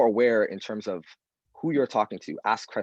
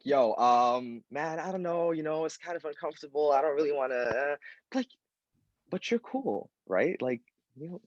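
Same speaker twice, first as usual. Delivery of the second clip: higher, hesitant and uncomfortable, trailing off softer; awkward reluctance; 5 semitones higher, 4 dB quieter than usual. Retold or imitated. Friend pressured by the community, awkwardly reluctant. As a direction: squirm out of it without offending.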